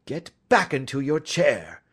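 firm, forceful order; stern detective cutting off the pleading. A narrator's reading. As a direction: order the culprit back with authority. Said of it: "said Holmes sternly".

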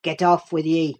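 understated gruff rebuff; gruff growl. A narrator's reading. Cousin rebuffs Catherine with a touch of gruffness. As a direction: growl it lightly, uncompromising. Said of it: "he growled, with uncompromising gruffness".

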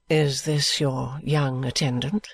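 low, slow, measured noblewoman's voice; a proud lady softening her tone with care; Lady Dedlock speaking gently to Miss Summerson; Lady Dedlock, kind but composed. A narrator's reading.

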